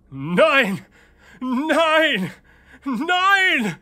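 screaming. Acted. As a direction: scream no in terror.

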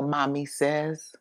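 plain reading voice

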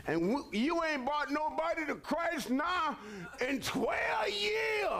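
full-force preaching; preaching shout. Acted. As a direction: call the lazy flock out from the pulpit. Preacher rebukes congregation.

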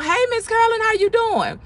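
bright high hello to a church friend; high, happy church-door hello; cheerful greeting. Pretend. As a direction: greet her like you're thrilled to see her.

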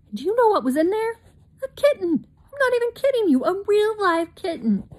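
bursting with delight about the kitten; excited. A narrator's reading.

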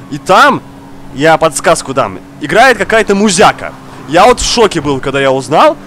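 usual speaking voice

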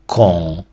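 "кон" as French qu'on with nasal vowel; careful, drawn-out model of "кон"; French reading. Shown as a demonstration. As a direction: sound out "кон" with n not sounded. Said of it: "Маємо".

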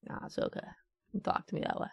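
very soft, near-whispered; hushed aside. Pretend. Player answering own mock outrage, hushed and soft. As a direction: drop to a tiny quiet voice.

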